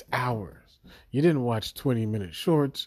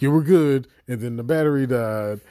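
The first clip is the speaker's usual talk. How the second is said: loud rant; 3 semitones higher, 7 dB louder than usual. Real, not acted.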